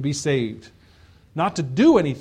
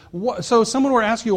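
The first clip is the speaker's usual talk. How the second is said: ordinary talk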